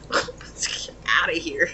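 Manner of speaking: whispered with a laugh, slow. Shown as a demonstration. Reader repeating the client's brush-off as a laughing whisper. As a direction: breathe it out, amused. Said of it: "repeats it whispered with laugh".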